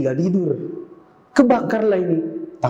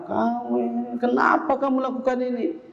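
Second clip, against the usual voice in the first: high-pitched shocked scolding; scolding parent; 9 semitones higher, 3 dB quieter than usual. Acted. Angry parent scolding a son home at midnight, high and shrill. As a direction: shriek the question at the child.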